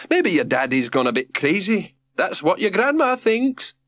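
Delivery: a touch of a Scots lilt; Scottish accent. Acted. Birdie voiced.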